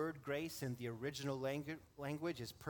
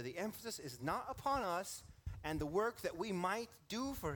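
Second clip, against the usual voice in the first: louder, raised preaching voice, driving the point home; fervent pulpit delivery, louder and higher; 4 semitones higher, 3 dB louder than usual. Acted. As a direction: build the sermon up toward its climax. Preacher builds to full force.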